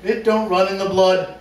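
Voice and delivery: laughing mock reading. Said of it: "we have a dramatic reading".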